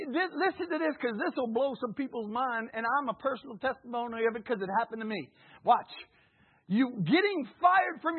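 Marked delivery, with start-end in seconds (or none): none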